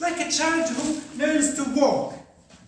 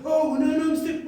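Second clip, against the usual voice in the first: higher, louder pretend shock; mock shocked; 5 semitones higher, 5 dB louder than usual. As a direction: imitate someone shocked by a slip. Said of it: "shouldn't be shocked".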